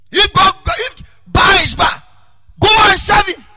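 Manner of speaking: full-force preacher shout as Elijah; shouted challenge. Acted. Elijah shouting at Baal's prophets, a booming challenge. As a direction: thunder it out to the crowd.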